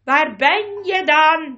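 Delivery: loud, slow call across water. Acted. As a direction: shout into the darkness to find him. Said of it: "riep Caspian".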